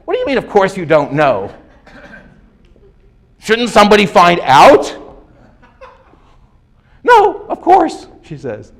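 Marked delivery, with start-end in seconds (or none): 0.1-5.0 loud, high incredulous challenge; mock outrage. Lecturer retorting to the expert's admission, incredulous. 7.0-8.1 very high, drawn-out mock reply; mock dismissal. Lecturer mimicking the expert's answer, dismissive.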